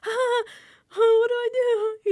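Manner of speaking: high, loud panicky impression; mock panic. Retelling a castmate's reaction to the snake, mock panic. Retold panic of Alex on camera.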